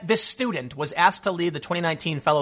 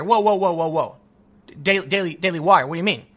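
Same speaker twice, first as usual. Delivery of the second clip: ordinary delivery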